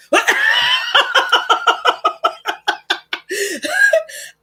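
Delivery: loud high burst of laughter, long and tapering; hearty laugh. Real, not acted. Woman cracking up at seeing herself on Oculus, hearty laugh. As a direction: crack up helplessly at your own story.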